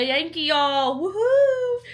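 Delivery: high, loud playful whoop; mock cheer. Mock celebrating becoming a Yankee.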